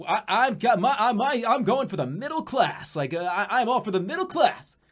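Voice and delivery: hammed-up candidate selling himself to the middle class; mock politician pitch.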